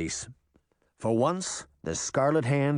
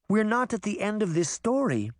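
lightly voiced girl, higher pitch; higher character voice for a worried child; Daphne explaining; 9 semitones higher than usual. A narrator's reading.

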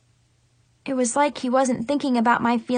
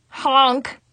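loud nose-blowing honk. A narrator's reading. Girl blowing her nose loudly while crying. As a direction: honk like a noisy nose blow. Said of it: "blew my nose rather loudly".

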